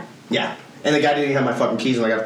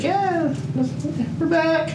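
excited; much slower than usual. Retold or imitated. Texting friends, announcing return.

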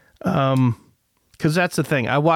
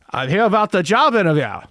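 higher, swooping cartoon Canadian accent; hammed-up Canadian accent with big pitch swings; South Park Canadian accent; 5 semitones higher, 3 dB louder, faster than usual. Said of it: "he sounds like South Park Canadian".

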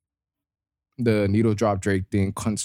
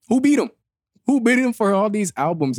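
who could beat him?; shocked disbelief; 10 semitones higher than usual. Retold or imitated. Mock outraged listener reaction.

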